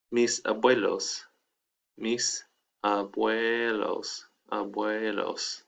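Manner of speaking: "Mis abuelos" stretched out slowly; slow model. Shown as a demonstration. Teacher repeating a new word to the class, slow. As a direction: draw out each syllable of "abuelos". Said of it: "teacher models slowly for students".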